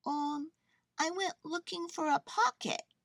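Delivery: high little bear voice; Corduroy. A narrator's reading. Bear explains himself to the girl, hesitant.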